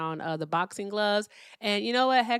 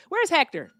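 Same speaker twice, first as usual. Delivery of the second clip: calling out; 9 semitones higher, 6 dB louder than usual. Real, not acted. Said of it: "calling for Hector".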